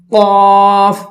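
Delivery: full-mouthed, drawn-out قاف on a level note; thick, heavy. Shown as a demonstration. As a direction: thicken the vowel of قاف and sustain it. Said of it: "اس کو ہم موٹا کر کے پڑھیں گے".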